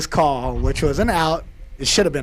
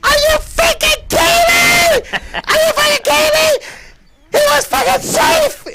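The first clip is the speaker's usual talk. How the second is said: very high, loud screaming rant; high-pitched furious shout; 9 dB louder than usual. Retold or imitated. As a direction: scream at the umpire in a squeaky voice. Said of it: "his high-pitched voice getting crazy".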